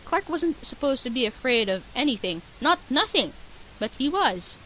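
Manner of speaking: ordinary talk, nothing marked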